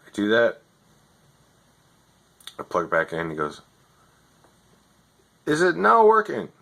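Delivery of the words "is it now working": higher, scripted helpdesk patter; overseas tech support voice. Retold or imitated. Overseas agent reading his script to a customer, lightly mocking. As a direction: read the script like a bored call-centre agent. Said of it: "You're not even in America".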